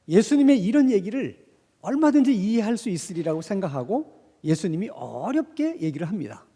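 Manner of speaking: ordinary talk, nothing marked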